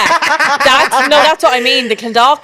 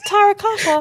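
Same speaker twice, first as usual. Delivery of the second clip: Terracotta squeaked much higher in a posh attempt at Limerick; high pitch posh; 13 semitones higher than usual. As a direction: try the Limerick accent and overshoot into squeaky posh. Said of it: "you went high pitch posh".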